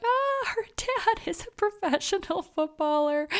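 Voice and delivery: high-pitched, drawn-out exasperated whine; mock exasperated wail. Viewer bursting with the contestant's secret, exasperated wail. Viewer voicing her pent-up stress.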